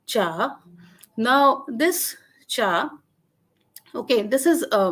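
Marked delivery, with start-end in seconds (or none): 0.1-0.6 drawn "cha" that falls and climbs back up; falling-rising tone. Shown as a demonstration. Lecturer repeating the Dogri example, "cha" falling then rising. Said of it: "with a falling, rising pitch". 2.5-2.9 long "cha", pitch dropping then rising; falling-rising tone. Shown as a demonstration. Lecturer showing the first Dogri word, "cha" falling then rising. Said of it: "with a falling, rising pitch".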